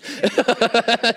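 high, spontaneous burst of laughter; real laugh. Real, not acted. Preacher amused by his wife's twitching, laughing.

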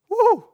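short, high, loud "woo"; quick high-pitched cheer; exultant whoop. Real, not acted. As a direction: let out a preacher's joyful whoop. Exults over the good news.

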